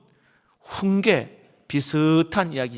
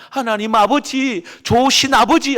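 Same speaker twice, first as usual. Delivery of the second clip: loud, higher, fervent cry to God the Father; calling out to God; 10 semitones higher, 12 dB louder than usual. Retold or imitated. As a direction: lift the voice up in prayerful calling. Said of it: "하잖아요".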